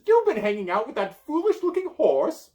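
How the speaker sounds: high, loud scorn; scornful wizard. Acted. Merlin mocking Pegasus to the child, high and loud. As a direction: sneer at the horse.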